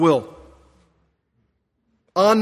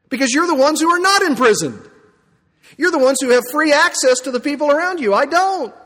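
imagined Paul; 6 semitones higher, 4 dB louder than usual. Pretend. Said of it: "for Paul to say".

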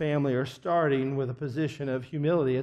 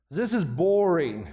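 a drawn-out bored complaint; bored addict; 3 semitones higher, 3 dB louder, slower than usual. Retold or imitated. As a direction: sigh it out, fed up and slow. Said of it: "they just agree to end the intervention".

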